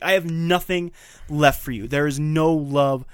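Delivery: forceful, declaimed with bitterness; bitter outburst. Retold or imitated. Wife ending love during office argument, bitter. Retold marital shouting match.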